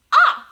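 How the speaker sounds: a loud, high, open "ah"; short O sound. Shown as a demonstration. Teacher to class, demonstrating the short O sound loudly. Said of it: "we know that O makes".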